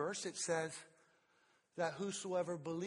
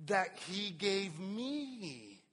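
slow emphasis; 4 semitones higher, much slower than usual. Shown as a demonstration. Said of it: "I want you to see it this way".

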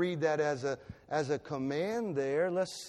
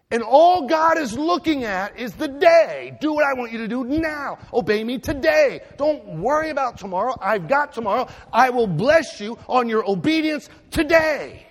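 loud, high preaching cry of God; booming divine command, high and loud; God's voice; 7 semitones higher, 10 dB louder than usual. Acted. Preacher voicing God at full force.

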